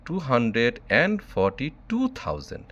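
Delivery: usual speaking voice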